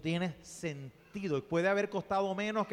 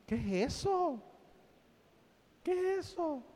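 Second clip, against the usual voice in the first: mock dismay; 11 semitones higher than usual. Speaker reacts to teachers in tight jeans, high-pitched mock dismay. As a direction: squeak it out in disbelief, higher the second time.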